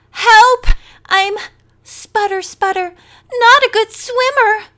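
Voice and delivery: high, loud hammed-up cry for help; mock Maggie's panic, overdone; mocking desperate cry. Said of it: "imitating Maggie's desperate cry".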